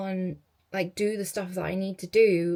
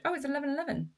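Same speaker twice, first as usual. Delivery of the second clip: pleasantly surprised; 7 semitones higher, much faster than usual. Real, not acted. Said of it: "let me quickly manifest".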